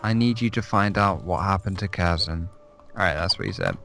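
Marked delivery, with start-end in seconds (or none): 0.0-2.5 narrator voice. Shown as a demonstration. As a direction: read it like a lovely narrator. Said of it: "read the quest text in a lovely narrator voice".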